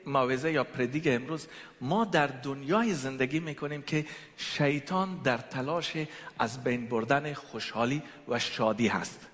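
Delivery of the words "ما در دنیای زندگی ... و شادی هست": normal talking voice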